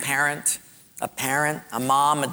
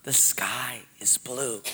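slow, hushed whisper; 4 semitones lower, 7 dB quieter, slower than usual. Acted. Teacher whispering a hearing test to pupils, slow whisper. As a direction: whisper it across a classroom. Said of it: "Sort of like she'd whisper".

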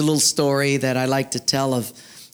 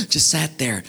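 ordinary talk, nothing marked

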